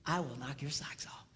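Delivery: soft teasing whisper; seductive whisper. Retold or imitated. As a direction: whisper it to your husband, teasing. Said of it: "you say, Honey".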